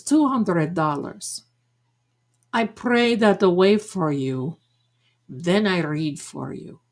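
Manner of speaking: put-on deliberate Madame Blavatsky accent; mock psychic accent. Psychic selling a prayer to a customer, fake accent. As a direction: imitate the psychic's accent, drawing out the price. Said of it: "I have that Madame Blavatsky accent".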